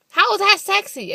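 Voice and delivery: high, loud disbelief; exasperated objection. Real, not acted. Woman disputing that a face can be sexy, exasperated.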